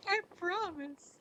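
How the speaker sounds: high, slow, sing-song I promise; squeaky promise. Pretend. Teasing a chat viewer, a squeaky high promise. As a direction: coo it like an innocent little voice. Teasing reassurance after stalker joke.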